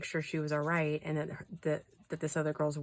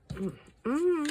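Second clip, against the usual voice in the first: disapproving hum; 11 semitones higher, 3 dB louder than usual. Real, not acted.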